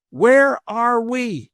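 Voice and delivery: high, slow, stretched-out holler; mock yell. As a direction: pretend to call down to a stranger far below. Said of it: "they yell out".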